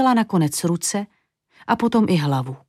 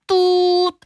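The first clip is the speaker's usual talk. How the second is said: high long hoot like a tram horn; 11 semitones higher than usual. A narrator's reading.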